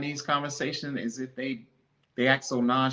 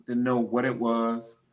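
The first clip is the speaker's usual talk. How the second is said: normal talking voice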